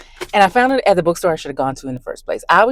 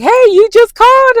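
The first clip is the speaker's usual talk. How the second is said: much higher, loud chorus greeting; excited staff greeting; 10 dB louder than usual. Retold or imitated. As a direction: call it out brightly. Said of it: "Everybody was like".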